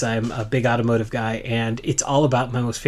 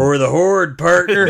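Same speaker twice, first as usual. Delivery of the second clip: loud Texan orc; rallying cry with a cowboy Texan twang; Texan accent; 3 semitones higher, 5 dB louder than usual. Acted. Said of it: "Orcs are Texan".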